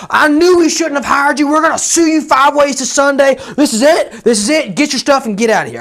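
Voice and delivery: high, loud, raging client voice; mock furious yell. Angry client screaming at the roofer, loud and high. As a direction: blow up at the contractor in a hammed-up shout. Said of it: "he is losing his mind on me".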